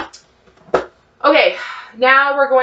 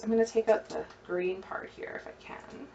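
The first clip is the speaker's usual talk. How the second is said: whisper; 13 dB quieter than usual. Real, not acted. Aside while trimming the onion.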